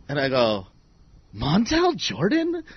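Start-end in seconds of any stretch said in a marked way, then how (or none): 1.3-2.6 very high, laughing disbelief; amused high-pitched mockery; incredulous. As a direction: retell a scoffing reply in a high, softened voice. Retells his scornful reply to challenger.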